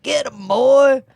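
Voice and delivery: put-on loud, high old-man ghost shout. Shown as a demonstration. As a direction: bellow it like an old grandfather ghost. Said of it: "That's just my ghost voice".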